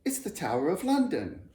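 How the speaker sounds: high, loud exclamation; boy exclaiming in delight; exclaimed. A narrator's reading. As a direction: announce it with thrilled surprise. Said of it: "exclaimed Jonathan".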